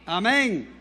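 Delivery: usual speaking voice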